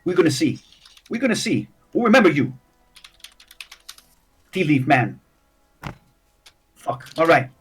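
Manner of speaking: lightly voiced halfling, grudgingly backing off; wary halfling gangster. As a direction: stand down while keeping up a tough front.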